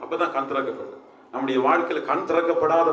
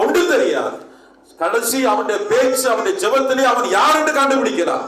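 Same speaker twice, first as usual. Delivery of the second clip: loud, high, driving pulpit delivery; forceful preaching; 6 semitones higher, 4 dB louder than usual. Acted. Preacher rousing his congregation, loud and high. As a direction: hammer each line home to the church.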